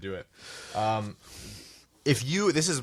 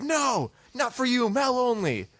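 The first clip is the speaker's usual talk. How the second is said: very high-pitched mock flight attendant; imitated crew member's squealed no; snooty refusal; 13 semitones higher, slower than usual. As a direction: shut the passenger down in a high put-on voice.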